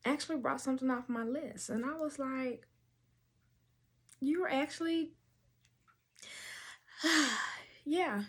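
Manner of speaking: holding back happy tears; choked up, pausing to hold it together; close to tears. Real, not acted. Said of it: "trying to make sure I don't cry again".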